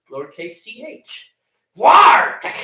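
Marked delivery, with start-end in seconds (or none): 1.8-2.6 "Voir tech" called out high and loud, war-tek; loud name reading. Shown as a demonstration. After spelling out the username, calling it out loud. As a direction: announce "Voir tech" with a loud rising flourish. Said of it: "It's capital V, lowercase a".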